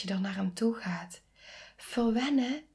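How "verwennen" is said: high rising disbelief on Verwennen; incredulous echo. Real, not acted. Echoing the advice she rejects, incredulous. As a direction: throw the word back as if absurd.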